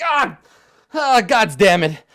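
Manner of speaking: high, loud sputter, slowed; spluttering curse. Acted. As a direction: curse as you surface soaking wet. NPC falls into river.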